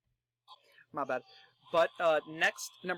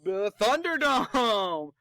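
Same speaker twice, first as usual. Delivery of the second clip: long, drawn-out announcer holler; hyped entrance shout; 4 semitones higher, 6 dB louder, much slower than usual. Pretend. Host imitating Kofi Kingston's entrance, drawn-out shout. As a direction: call it out big, stretching the words.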